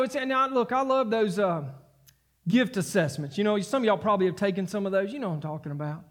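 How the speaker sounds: ordinary delivery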